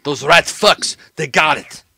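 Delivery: loud, exasperated henchman; agitated criminal associate. Acted. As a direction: spit out the bad news, rattled.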